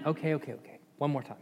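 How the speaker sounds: soft, low string of okays; resigned placating. Acted. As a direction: give in under your breath. Balaam gives in, third time.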